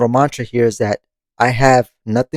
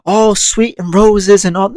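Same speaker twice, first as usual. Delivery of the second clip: mock sugarcoating; 6 semitones higher, slower than usual. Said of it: "I'm not gonna lie to you and be like".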